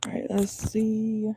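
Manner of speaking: slow whisper to herself; whispered aside. Real, not acted. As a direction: breathe it out under her voice, slowly. Muttering while getting set up.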